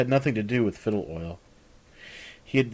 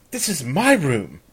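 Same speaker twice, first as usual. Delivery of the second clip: indignant protest; 5 semitones higher, 6 dB louder than usual. Acted. Chandra indignant at Aron.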